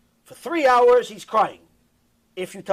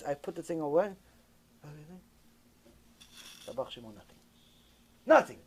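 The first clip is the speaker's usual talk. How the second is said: normal talking voice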